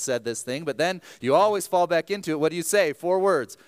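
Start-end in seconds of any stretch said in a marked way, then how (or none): none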